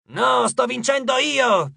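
high, slow, loud stubborn protest. Acted. Coach refusing to leave the fight, stubborn protest. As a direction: refuse like a scrappy old satyr. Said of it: "protestò Edge".

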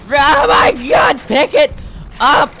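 loud, slow, high yell at the character; frustrated shout. Real, not acted. Gamer yelling at the character to pick it up. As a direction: yell it out in exasperation. Urging character to grab item.

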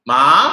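MA drawn out with pitch climbing upward; second tone. Shown as a demonstration. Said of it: "langsung nada dua".

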